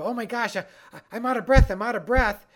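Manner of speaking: high, loud, quick mock panic; acted panicking brain. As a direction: voice a frantic brain short of air. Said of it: "the nervous system start to go".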